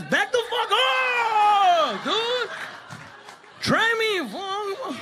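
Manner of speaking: high, drawn-out mock shout; mock tough. Kid practicing punches in his room, high mock-tough shout. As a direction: stretch the threat into a hammed-up scream. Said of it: "You start practicing your punches".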